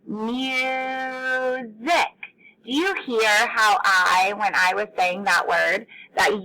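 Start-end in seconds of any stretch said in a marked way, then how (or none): 0.1-1.7 "Music" with the U held long on a higher note; stretched-out vowel. Shown as a demonstration. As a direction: draw out "Music" as muuu-sic. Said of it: "held out that U".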